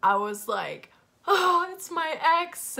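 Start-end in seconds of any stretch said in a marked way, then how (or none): 1.2-2.8 high, loud crying voice, words breaking; retold bawling. Telling best friend on the phone, mock bawling. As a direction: sob it out, voice pitched up and strained. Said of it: "I just start bawling my eyes out".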